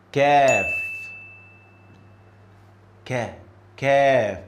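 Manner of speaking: 'Kaf' light, with a little smile; light Kaf. Shown as a demonstration. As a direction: keep 'Kaf' light and smiling. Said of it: "but this one is light".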